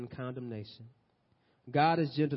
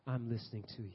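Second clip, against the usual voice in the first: soft, low divine reassurance; hushed, patient voice of God; gentle listening; 5 semitones lower, 10 dB quieter, faster than usual. Acted. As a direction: drop low and quiet to comfort. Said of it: "What, Elijah?".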